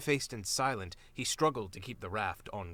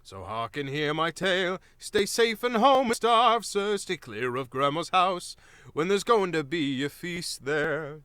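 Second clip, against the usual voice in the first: a lightly voiced old storyteller, slower and louder toward the end; ominous old rhyme; 6 semitones higher, 5 dB louder than usual. A narrator's reading. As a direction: tell the warning like a grim old grandfather.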